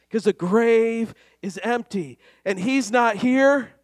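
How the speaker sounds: impassioned Easter sermon; full-force preaching. Acted. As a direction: preach it with rising fervor.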